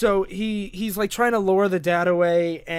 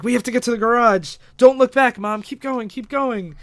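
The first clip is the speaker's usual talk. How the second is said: higher and louder, pressing mom to keep moving; mock panicked urging, easing at the end; urgent retold shout; 5 semitones higher, 6 dB louder than usual. [name] urging mom to flee.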